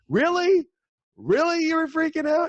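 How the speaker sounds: high, incredulous mock voice of God; playful high-pitched disbelief; teasing God. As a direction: tease them like an amused deity. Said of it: "God sitting there laughing with you going".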